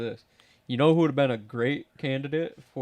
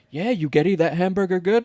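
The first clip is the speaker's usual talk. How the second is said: higher, louder PA call to a rider; single out the kid over the speakers; mock announcer ribbing; announcer teasing call; 5 semitones higher, 4 dB louder than usual. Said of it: "and he's like".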